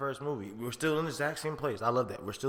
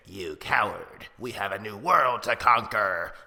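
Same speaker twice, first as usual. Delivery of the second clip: Goblin voice; 7 dB louder, slower than usual. Retold or imitated. The Goblin persona taunting Norman from inside his head, sneering and booming.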